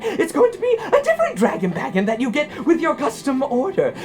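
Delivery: high, manic voice full of laughter; crazed prize reader. Acted. [name] reading out prizes, laughing maniacally. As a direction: read it out as if losing your mind, cackling. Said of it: "as I go crazy over here".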